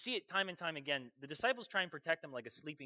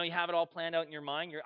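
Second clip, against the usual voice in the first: ordinary talk, nothing marked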